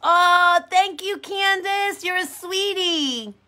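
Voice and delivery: high, slow, sugary gratitude; sweetly touched, lingering on each word; gushing thanks. Real, not acted. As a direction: coo it high and drawn out. Thanks a viewer for a compliment.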